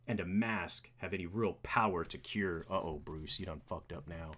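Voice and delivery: low, measured hero questioning politely; understated low character voice; skeptical Bruce Wayne. Acted.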